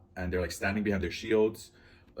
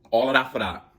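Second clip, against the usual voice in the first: higher and much louder, fed-up exclaim; exasperated punchline; 6 semitones higher, 11 dB louder than usual. Real, not acted. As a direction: land the anticlimax with disbelief. Police leave, he wasn't home.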